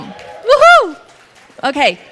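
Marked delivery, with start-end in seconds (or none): 0.0-1.0 high, loud woohoo; excited cheer. Real, not acted. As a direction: cheer them on. Cheering for South Africa.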